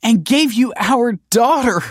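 very high, loud protest. A narrator's reading. Kelsey protests that she gave him their daughter, crying out. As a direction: cry it out in desperate self-defence. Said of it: "protested Kelsey".

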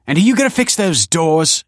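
high, loud indignant demand; irritated manager telling someone off; snapping manager. A narrator's reading. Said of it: "she added".

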